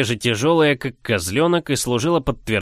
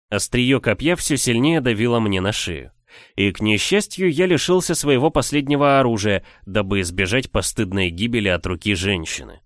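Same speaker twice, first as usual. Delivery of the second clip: ordinary delivery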